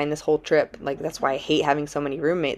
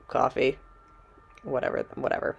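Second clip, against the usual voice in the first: dismissive, whispered and soft; tired hushed shrug; weary whisper; 3 semitones lower, 5 dB quieter than usual. Retold or imitated. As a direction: brush it off, barely voiced. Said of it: "And I was like".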